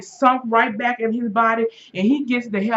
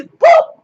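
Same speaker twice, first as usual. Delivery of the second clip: pop sound; 11 dB louder than usual. Retold or imitated. Sound of hand striking.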